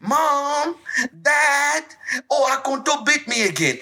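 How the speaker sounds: put-on high, whiny child calling for parents; whining child. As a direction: play a kid tattling on the ant, voice way up.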